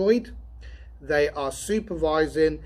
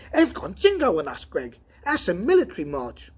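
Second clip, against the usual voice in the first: higher, louder alarmed cry; 5 semitones higher, 5 dB louder than usual. Acted. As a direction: blurt it out in alarm. Donovan alarmed at marching robots.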